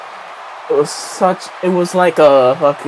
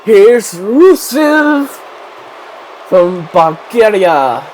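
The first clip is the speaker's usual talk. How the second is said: hammed-up Bulgarian accent, much higher, louder and slow; mock Bulgarian accent; 6 semitones higher, 6 dB louder, slower than usual. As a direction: announce him in a silly put-on Bulgarian accent. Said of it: "said that in a fucking accent".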